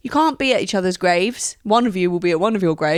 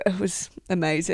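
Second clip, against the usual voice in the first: normal talking voice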